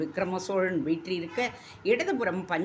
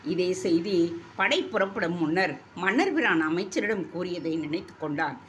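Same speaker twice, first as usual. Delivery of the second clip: ordinary delivery, nothing marked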